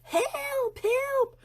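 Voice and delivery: high, cried-out help, help; calling for help. Retold or imitated. Mother calling her kids to the flooded room: high, urgent cry. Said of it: "So I start yelling for my kids".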